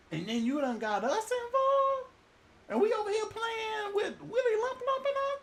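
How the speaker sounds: high, crying mock complaint; mock-tearful sailor complaining to Jonah; whining sailor. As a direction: wail it like a betrayed sailor.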